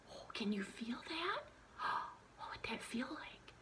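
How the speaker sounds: breathless whispered wonder; hushed storyteller. Shown as a demonstration. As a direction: make them feel the height. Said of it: "And then I say".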